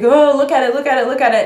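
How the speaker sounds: loud, high mock cajoling; teasing snake holder. Someone teasing a snake-phobic, mock cajoling. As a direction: push the snake at them. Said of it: "they're like".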